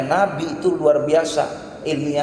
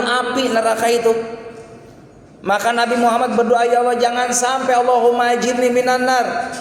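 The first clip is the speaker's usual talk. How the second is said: preaching at full force; 6 semitones higher than usual. Acted. Preacher warning the congregation about hellfire, high and fervent. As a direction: lift the congregation with fiery urgency.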